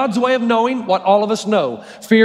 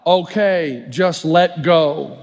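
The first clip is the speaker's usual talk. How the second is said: lower, very slow God voice; deadpan God; 5 semitones lower, much slower than usual. Acted. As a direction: drop it low and slow, a dry divine punchline. God answers the hanging man.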